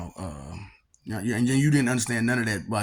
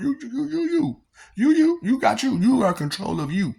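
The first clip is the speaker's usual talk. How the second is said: high, loud insistence on you; emphatic urging; 14 semitones higher, 6 dB louder than usual. Real, not acted. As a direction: hammer the word you home, loud and high. Urging listener to take control.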